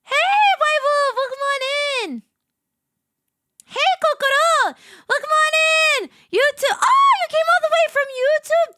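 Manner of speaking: high, loud hollered greeting; excited welcome. Real, not acted. Streamer greeting viewers as they arrive, excited holler. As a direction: call out to each newcomer with big enthusiasm.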